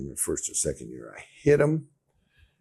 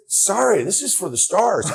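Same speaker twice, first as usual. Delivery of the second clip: higher, louder playful snub; mock snooty; 14 semitones higher, 7 dB louder than usual. Actor shuts his roommate out of the trailer, mock snooty. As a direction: play the big star shutting him out.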